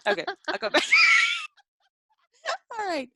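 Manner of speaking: high, loud laughing. Real, not acted. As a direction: laugh while getting back on track. Laughing trying to resume.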